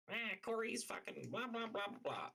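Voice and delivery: squeaky high mocking whine of her complaints; mock her rant in a silly high squeak.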